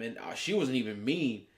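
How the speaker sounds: ordinary talk, nothing marked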